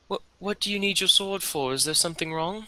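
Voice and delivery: put-on high-pitched little boy; young boy's voice. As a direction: ask it in a high child's voice. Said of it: "you hear a young boy's voice saying".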